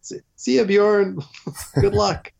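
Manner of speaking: hammed-up sarcastic see-ya; sarcastic goodbye. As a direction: brush him off sarcastically. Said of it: "And I'm like".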